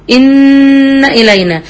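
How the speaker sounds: إن إلينا with the noon's ghunna pulled into an alif; wrong version. Shown as a demonstration. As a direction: imitate the mistake, stretching an alif after إن. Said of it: "ما جيبش ألف".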